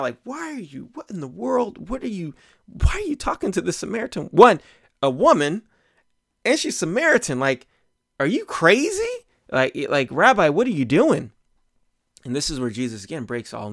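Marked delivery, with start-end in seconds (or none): 0.2-11.3 high, incredulous mock outcry; disbelieving disciples. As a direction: play the shocked disciples, pitched way up. Disciples voiced, tripping out.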